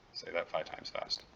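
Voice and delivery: breathy self-mocking aside under the breath; whispered aside. Real, not acted. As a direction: drop to a whisper, laughing at your own stumble. Mumbled aside after tongue-tripping.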